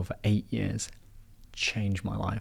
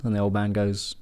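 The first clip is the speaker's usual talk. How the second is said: ordinary delivery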